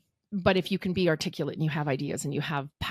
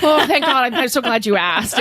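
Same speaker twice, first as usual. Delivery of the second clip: loud, high, laughing relief; retold reply, played up loud; gushing relief; 6 semitones higher, 10 dB louder than usual. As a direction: blurt it out, hugely relieved and much louder. Acts out eager relieved reply.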